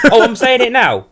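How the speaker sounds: high, loud protest with laughter; loud comic retort; exasperated shout back. Real, not acted. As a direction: yell it back in mock indignation.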